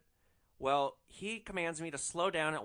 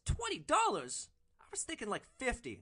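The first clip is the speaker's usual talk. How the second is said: mock outraged cry; 11 semitones higher, 4 dB louder than usual. Woman in the car hearing twenty dollars, very high outraged cry. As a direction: play her shocked at the price. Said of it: "She gasps and she cries".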